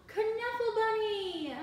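high, loud, drawn-out squeal; joyful toddler cry. A narrator's reading. Toddler finding her lost bunny, high excited shout. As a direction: call it out in delighted discovery.

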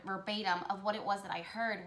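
ordinary talk

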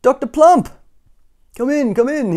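high, calling doctor voice; wheezing doctor. A narrator's reading. Doctor Plump calling Mister Skinny in, wheezed welcome. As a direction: call the visitor in, high and hearty. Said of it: "he wheezed as Mister Skinny knocked".

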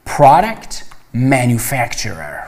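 پروداکت with an open "a", pra-dekt, then slow مانیفکتچرر; pronunciation demo. Shown as a demonstration. Teacher repeating the term for students, slow and deliberate. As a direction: model the word slowly for the class. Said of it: "به تلفظش دقت کنید".

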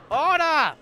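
loud high bark with a falling end; judge shouting order. Acted. Judge silencing the court, loud and high. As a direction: slam it down like a gavel.

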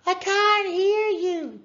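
very high, loud, slow call; high holler through the glass; crying out. A narrator's reading. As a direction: shout that you can't hear. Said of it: "cried Mags".

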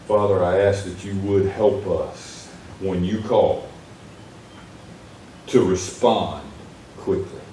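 soft, low closing prayer. Acted. Pastor prays over congregation, soft reverent prayer. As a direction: pray humbly, fading at the end.

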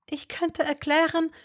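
high, hopeful offer. A narrator's reading. Hermine hopefully offering to Malfoy. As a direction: offer it with hope. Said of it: "fing sie hoffnungsvoll an".

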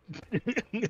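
hohes kurzes Auflachen; kurzes Lachen. Real, not acted. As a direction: lacht kurz los. Lacht über Berg. (kurzes Lachen)

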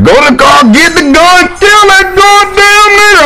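high, hammed-up hostile crowd voice, slowing on the last words; mock angry crowd. Tennessee crowd yelling at the wrestler, hammed-up shrill mock yell. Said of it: "they was like".

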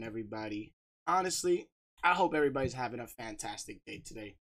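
usual speaking voice

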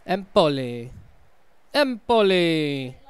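put-on Empoli drawn out slowly in a silly Italian accent; mock Italian accent. Gamer mangling the club name in a mock Italian accent. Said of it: "putting on some stupid, like, accent".

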